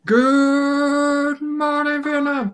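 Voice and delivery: very high, very slow drawn-out radio call; Robin Williams. Retold or imitated. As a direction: stretch it out like a DJ hollering a greeting. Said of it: "I'm supposed to be Robin Williams".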